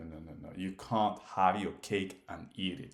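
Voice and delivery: ordinary talk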